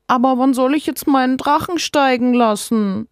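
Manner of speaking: put-on high childlike voice, a touch plaintive; wistful child asking to go out; sad little girl. Sick girl asks Mama about her kite, mildly sad. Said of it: "fragt Sophia traurig".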